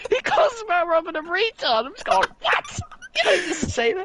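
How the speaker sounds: high-pitched, incredulous outburst; hammed-up shocked reaction; scandalized disbelief. Reader appalled at Batman calling Robin names, scandalized.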